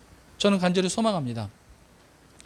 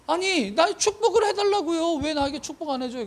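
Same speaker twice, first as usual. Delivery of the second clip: higher-pitched, insistent plea of a son; acted complaint to a father, pitched up; pleading protest; 6 semitones higher than usual. Acting Esau pleading with his father. (높은 톤으로 따지듯 조르기)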